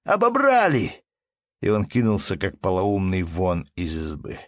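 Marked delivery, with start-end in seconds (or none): 0.0-1.0 very high, loud outcry; merchant's wail. A narrator's reading. Merchant learns of the robbery, wailing. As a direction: wail it out in alarm. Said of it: "завопил купец".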